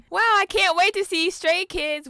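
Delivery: loud, high mock gushing; imitated fangirl excitement. Teasing co-host by imitating her concert excitement, gushing. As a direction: gush loudly, teasing a fan. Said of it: "What Carolina really means".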